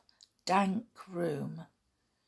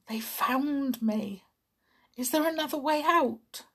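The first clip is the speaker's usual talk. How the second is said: frightened; 7 semitones higher than usual. Acted. Boy panics at footsteps.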